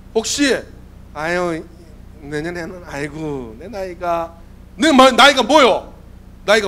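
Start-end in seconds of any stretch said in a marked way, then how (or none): 1.1-4.4 low, soft, tired grumbling; mock too old to carry on; weary excuse-maker. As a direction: sigh out a feeble excuse about age. Voices weary teachers pleading age.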